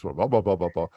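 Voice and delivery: news reporter cadence. Shown as a demonstration. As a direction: read it like a TV reporter. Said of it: "I sound like a reporter".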